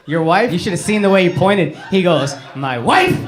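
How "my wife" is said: mock-angry low bark; short, resentful rising jab; angry husband imitation. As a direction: imitate a fed-up husband pointing out his wife. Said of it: "There's a lot of anger in there".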